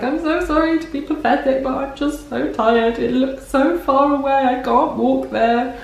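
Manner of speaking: half-hearted imitation of her own tired, tearful self; tearful apology. Retelling to viewers how she apologised to Henry in tears, lightly mimed weepy voice. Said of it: "I was crying and I was like".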